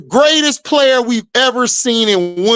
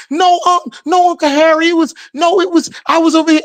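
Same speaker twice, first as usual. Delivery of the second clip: put-on higher, rushed kid's excuse; lying kid; 5 semitones higher, faster than usual. Nieces lie to Uncle Harry, high and rushed. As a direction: babble a fib fast. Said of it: "Then they try to expand on the lie".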